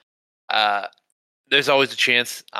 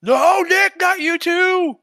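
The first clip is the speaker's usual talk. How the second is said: much higher, louder, hammed-up despair; mock panicked listener; 6 dB louder, much slower than usual. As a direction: wail it like a listener losing hope.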